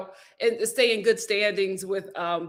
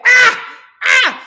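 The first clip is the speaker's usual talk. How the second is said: high, loud fake cries of pain; mock pained cries; 13 semitones higher, 12 dB louder than usual. Hobbling back past the boss, mock pained cries. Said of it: "I hobbled back".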